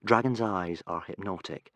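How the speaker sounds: ordinary delivery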